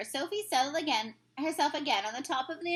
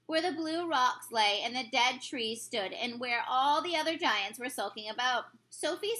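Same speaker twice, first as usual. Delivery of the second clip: ordinary delivery, nothing marked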